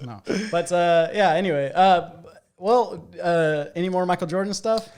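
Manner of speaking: ordinary talk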